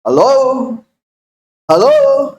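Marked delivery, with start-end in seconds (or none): 1.7-2.3 hello called out very high; high calling hello. Real, not acted. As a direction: call hello up high, checking for a reply. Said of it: "hello, calling for response".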